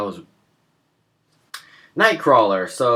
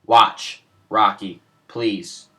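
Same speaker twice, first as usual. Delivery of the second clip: usual speaking voice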